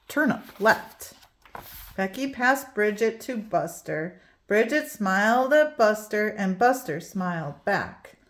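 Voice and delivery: usual speaking voice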